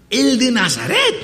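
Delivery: high, rising disbelief; astonished question. Acted. Blind beggar hears it is Jesus of Nazareth, astonished.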